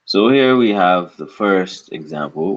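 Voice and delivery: usual speaking voice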